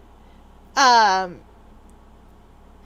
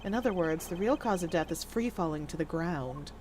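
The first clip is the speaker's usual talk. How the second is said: low, soft, deliberate character voice; hushed, measured deduction; cool, composed investigator; 6 semitones lower, 7 dB quieter than usual. Acted. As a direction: reason it out quietly and formally.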